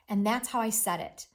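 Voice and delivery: ordinary talk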